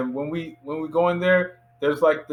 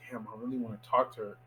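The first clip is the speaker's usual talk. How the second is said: soft, quick, low aside; retold wistful mutter; muttered to self; 5 semitones lower, 12 dB quieter, much faster than usual. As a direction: say it under your breath. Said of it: "this lady caught me say that".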